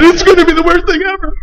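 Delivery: high whiny lament; mock despair. Whines under blankets.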